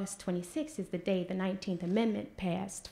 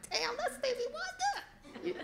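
much higher, laughing fan squeal; starstruck squeal; 5 dB quieter, faster than usual. Retold or imitated. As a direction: gasp out the name like a thrilled fan. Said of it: "I'm like".

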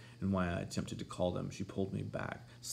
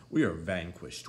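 mock despair; 4 dB louder, slower than usual. A narrator's reading. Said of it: "exclaimed Missus Linton".

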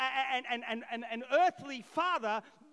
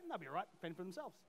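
much softer, lower and fast; throwaway aside; 7 semitones lower, 10 dB quieter, much faster than usual. Real, not acted. Pastor joking to church about kids, quick soft aside. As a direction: toss it off under your breath.

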